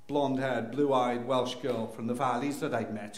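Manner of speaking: normal talking voice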